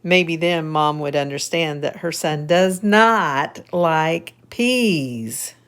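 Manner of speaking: lively storybook reading; reading to children. A narrator's reading. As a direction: read it brightly to little kids. Reads picture book aloud.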